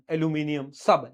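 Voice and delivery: usual speaking voice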